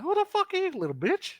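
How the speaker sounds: high, loud sassy ranting woman; sassy woman npc. Acted. GM voicing the dancer, ranting to the players in a high brassy voice. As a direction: rant about goblins in a shrill high voice. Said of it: "She's like".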